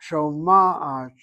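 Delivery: Shoma'at said loudly as sho-MAA-at; Shoma'at stressed on MA, not the last syllable; emphasis on middle syllable. Shown as a demonstration. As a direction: punch and stretch the MA in Shoma'at. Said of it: "shows you where the emphasis is".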